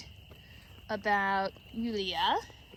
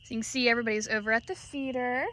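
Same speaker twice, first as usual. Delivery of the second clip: ordinary delivery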